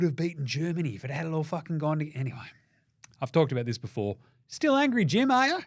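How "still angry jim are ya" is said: high, teasing sing-song at himself; mock teasing. Podcaster teasing himself about the Boomers loss, high mock voice. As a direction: needle yourself like a cheeky mate.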